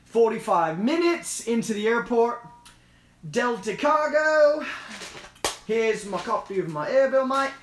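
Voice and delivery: put-on Australian customer at the cargo desk; Australian accent. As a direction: play an Aussie picking up freight. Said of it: "I'll try to do an Australian".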